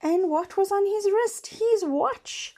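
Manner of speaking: high, loud quiz questions; high lively questions and answer; excited storytelling. Acted. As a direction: quiz the kids eagerly. Animated questions to children.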